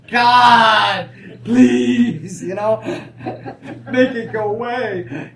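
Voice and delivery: loud, slow pleading with a laugh breaking in; hammed-up beg for God to take it away; mock begging. Preacher mimics a beaten believer begging God, laughing plea. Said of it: "you see how begging you get".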